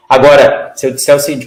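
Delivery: ordinary delivery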